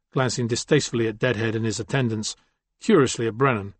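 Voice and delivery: plain reading voice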